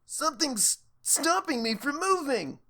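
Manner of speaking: high, strained panic; panicked squirming. Acted. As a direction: fight to move. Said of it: "The bully squirmed".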